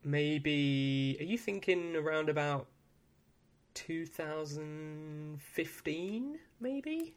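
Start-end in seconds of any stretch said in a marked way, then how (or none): 3.7-7.2 slow drawn out. Acted. Drawn-out suggestive question.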